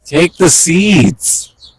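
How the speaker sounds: slow, high coaxing plea; coaxing birds. Real, not acted. To the doves at the feeder, slow high coaxing.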